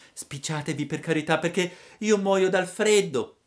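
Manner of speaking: acuto, forte e svelto; burattino impaziente. A narrator's reading. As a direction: supplica affrettato. Pinocchio supplica. (Pinocchio supplica la lumaca, svelto e acuto)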